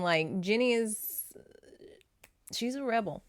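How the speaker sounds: ordinary delivery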